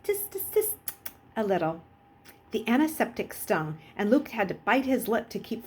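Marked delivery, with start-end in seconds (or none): 0.0-0.8 tutting. Acted. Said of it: "this woman only nodded and".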